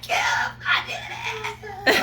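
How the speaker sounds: loud, squealing acted-out announcement; acted-out overjoyed yell; triumphant shout. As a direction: cry out the big news to your leader. Acting out announcing success to Kim.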